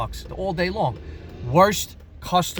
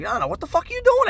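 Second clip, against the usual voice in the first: much higher, louder outraged no, no; outraged yell; 6 dB louder, faster than usual. Retold or imitated. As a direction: yell to stop a coworker crushing the car. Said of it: "I'm like".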